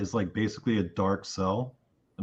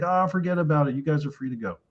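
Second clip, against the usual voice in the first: lightly voiced jolly judge, pitched up; jolly old judge; 5 semitones higher than usual. Judge casually freeing the group, lightly imitated jolly dismissal. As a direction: wave it off like a jolly old man. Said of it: "like, jolly, right?".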